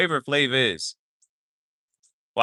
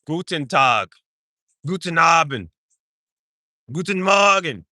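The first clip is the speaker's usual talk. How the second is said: loud, hearty German greetings; playful German accent, building louder; mock German accent; 3 semitones higher, 4 dB louder than usual. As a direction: belt out greetings in put-on German. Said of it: "That shit look like German".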